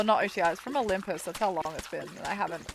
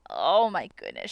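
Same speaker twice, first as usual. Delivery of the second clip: ordinary talk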